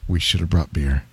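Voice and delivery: low, soft mock voice of a regretful friend; Missus Ramsey impression. Quoting his buddy on the river trip, low mock mumble. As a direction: drop the voice low and sigh it out. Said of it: "Missus Ramsey looks at me and he goes".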